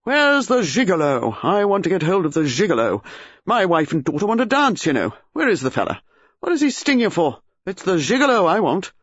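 blustering shout at the manager; booming old British colonel demanding the gigolo; top of his voice. A narrator's reading. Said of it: "said at the top of his voice".

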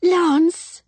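high, loud cry of welcome; excited greeting call. A narrator's reading. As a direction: call out delightedly to someone arriving. Sister greets arriving brother, hurrying.

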